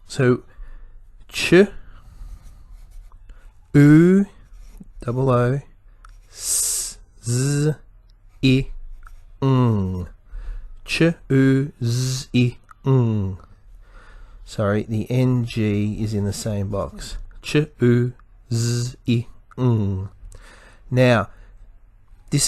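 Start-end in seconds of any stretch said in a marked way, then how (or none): 1.3-4.3 ch, a pause, then a held u; sounded out. Shown as a demonstration. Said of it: "do your segmenting". 6.3-10.1 s, z, i, ng as isolated sounds; sounded out. Shown as a demonstration. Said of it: "do your segmenting". 10.8-13.4 Ch, u, z, i, ng sound by sound. Shown as a demonstration. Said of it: "do your segmenting". 17.4-20.1 Ch, u, z, i, ng in separate sounds; sounded out. Shown as a demonstration. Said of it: "do your segmenting".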